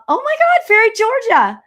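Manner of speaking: very high, loud squeal; excited greeting squeal. Real, not acted. Reader spots Fairy Georgia joining the live, squealing with excitement. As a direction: burst out at a surprise arrival.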